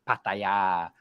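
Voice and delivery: "Pattaya" as pat-ta-YAA, low; Thai pronunciation. Shown as a demonstration. Naming where the expressway goes, Thai pronunciation. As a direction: stretch the final AA of "Pattaya".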